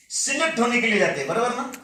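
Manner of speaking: ordinary talk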